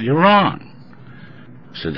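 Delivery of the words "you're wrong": high, loud, flat-out telling him he's wrong; blunt verdict. Retold or imitated. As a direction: hand down the verdict, pitched up and louder. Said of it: "He said".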